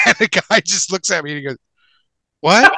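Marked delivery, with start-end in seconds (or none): 2.4-2.7 high, loud incredulous yelp; baffled disbelief. Retold or imitated. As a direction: blurt it out in sheer bafflement. Retold exchange with attendant.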